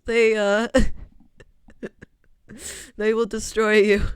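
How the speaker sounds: ordinary delivery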